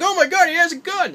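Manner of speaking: high, loud mock panic; panicked poacher. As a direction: shriek it like a scared poacher. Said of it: "the poacher's like".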